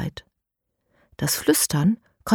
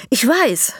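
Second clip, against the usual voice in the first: high, loud, winded reply; breathless; 7 semitones higher, 5 dB louder than usual. A narrator's reading. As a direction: answer while catching breath. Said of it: "antwortet Elias atemlos". (atemlos, hoch und laut)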